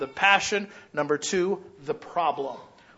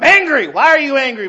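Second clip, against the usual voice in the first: loud, high exasperated complaint; angry complaint; 8 semitones higher, 10 dB louder than usual. Acted. Church member complaining to pastor, loud and high. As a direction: insist you're angry.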